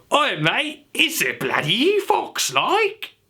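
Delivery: high, drawn-out mock British, rising at the end; British accent. Shown as a demonstration. Accent teacher translating quick brown fox, exaggerated British. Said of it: "sound like in a British accent".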